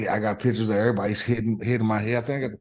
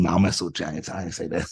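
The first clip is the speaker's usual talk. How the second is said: normal talking voice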